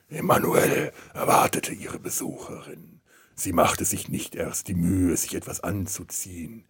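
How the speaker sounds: hushed, breathy erotic story reading; sultry reading. Acted. Reads erotic novel aloud.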